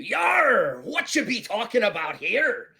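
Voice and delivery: loud, high-pitched pirate holler; roaring pirate. Acted. Pirate surprising the crew from behind, roaring. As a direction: burst in with a booming "Yar".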